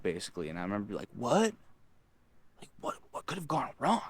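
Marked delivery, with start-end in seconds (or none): 1.1-4.0 baffled. Retold or imitated. Acts shock at being dumped.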